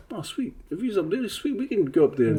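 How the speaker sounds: high-pitched, lightly acted delight; inspired enthusiasm. Fellow islanders see him up there, imagined as mildly excited. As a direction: voice someone newly encouraged, pitch lifted.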